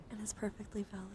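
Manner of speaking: ordinary talk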